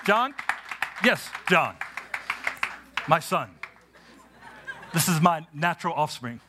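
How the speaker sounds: high, driving preacher's cadence with shouted peaks; full-force preaching. Acted. Pastor preaches to the congregation at Grace Covenant, high and fervent. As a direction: preach it with rising fire.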